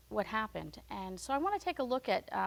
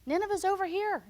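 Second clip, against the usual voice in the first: higher, louder calling out to a runaway; calling God's voice; 9 semitones higher, 4 dB louder than usual. Retold or imitated. The Lord calling after fleeing Jonah, raised voice. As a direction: call after him, pointing the way. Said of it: "I could almost hear the Lord saying".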